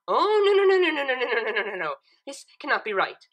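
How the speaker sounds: loud, high, frantic string of no's; shouting. A narrator's reading. Twilight shouting in disbelief at the chalkboard math. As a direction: blurt it out in loud panic. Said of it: "Twilight shouted".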